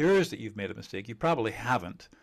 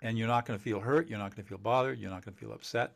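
ordinary talk, nothing marked

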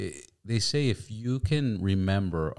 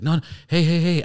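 pleading calls; 9 semitones higher, 6 dB louder than usual. Retold or imitated. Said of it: "he's like".

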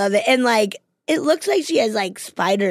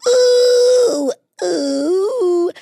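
excited squeal; 9 semitones higher, 5 dB louder than usual. Real, not acted. Excited reaction to French.

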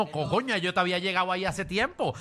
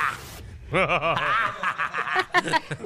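evil laugh. Acted. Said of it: "Diablo, risa malvada".

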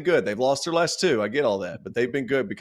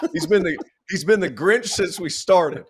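laughing tease; 8 semitones higher, 4 dB louder than usual. Real, not acted. Teasing co-host as the Grinch.

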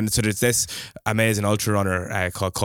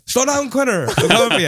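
high, raised playful call; imagined taunting shout; 13 semitones higher, 6 dB louder than usual. Pretend. Runner warning Quinner from behind, high mock shout. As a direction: pretend to holler at the runner ahead.

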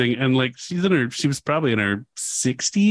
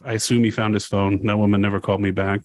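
normal talking voice

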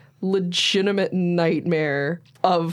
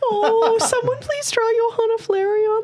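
high gushing voice. Real, not acted. Gushing squeal.

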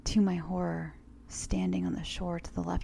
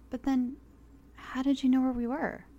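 puzzled question; 7 semitones higher than usual. Acted. Elderly woman puzzled.

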